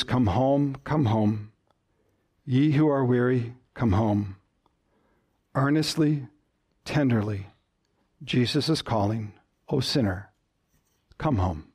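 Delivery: low, hushed recitation sinking to the end; soft, earnest, fading invitation; softly and tenderly. Shown as a demonstration. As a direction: recite the hymn words like a gentle call. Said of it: "softly and tenderly".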